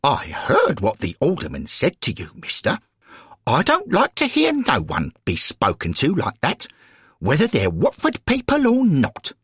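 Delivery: put-on plain-spoken old lady, voice rising high; indignant elderly lady supporter. Florence, elderly lady, speaks.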